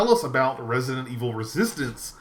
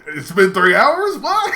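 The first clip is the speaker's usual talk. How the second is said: high, loud disbelieving gasp; amazed at how time flew; astonished; 8 semitones higher, 8 dB louder, slower than usual. Retold or imitated. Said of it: "and it was like".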